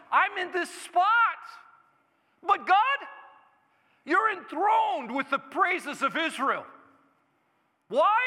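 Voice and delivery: high, loud, slow preaching cry; full-force preaching. Acted. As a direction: preach it out with everything.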